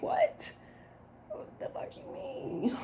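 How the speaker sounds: very high, soft squeak of disbelief; mock disbelief. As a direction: imitate a baffled comeback in a tiny high voice. Acting out their startled comeback.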